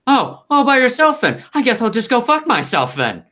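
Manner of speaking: loud, high-pitched offended ghost; indignant outburst. Acted. Ignored dead mentor butting in from behind, loudly indignant.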